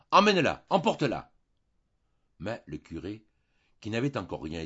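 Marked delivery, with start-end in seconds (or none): 0.1-1.3 high, loud call to her father; desperate shout. Acted. Jeanne calling her father to remove the maid, shouting. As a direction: cry out to have her taken away. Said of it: "Jeanne appela son père".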